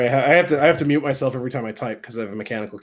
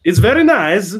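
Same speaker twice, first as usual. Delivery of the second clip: loud, high mock Kazakh accent; Borat; 4 semitones higher, 6 dB louder than usual. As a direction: enthusiastic fake Kazakh accent. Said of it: "Can anybody do Borat?".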